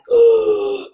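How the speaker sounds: sharp, loud, high gasp; shocked gasp. Retold or imitated. Meeting room shocked by the late woman, loud gasp.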